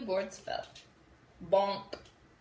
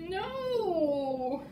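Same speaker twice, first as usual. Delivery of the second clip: mock dismay; 7 semitones higher than usual. Dismay at fall.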